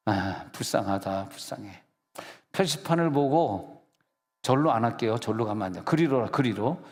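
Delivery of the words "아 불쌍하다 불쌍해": low, slow mock-pitiful sigh; fading mock lament for himself; mock pity. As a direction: feel sorry for yourself, hammed-up. Self-mocking sigh after joke flops.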